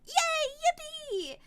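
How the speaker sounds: very high, drawn-out squeal of joy; hammed-up girlish yippee; gleeful cheer. Heroine celebrates, acted.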